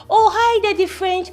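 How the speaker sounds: high, loud delighted hello; gushing greeting. Acted. Streamer spotting a follower on her live, gushing. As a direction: greet a fan with showy delight.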